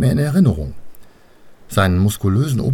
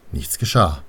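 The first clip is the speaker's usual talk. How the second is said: usual speaking voice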